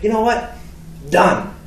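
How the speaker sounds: retold heated comeback; defiant. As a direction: accept the dare with force. Acted son taking the dare.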